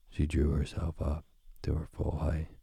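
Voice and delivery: usual speaking voice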